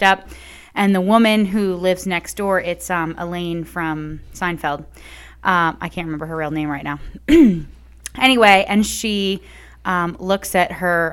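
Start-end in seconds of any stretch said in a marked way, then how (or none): none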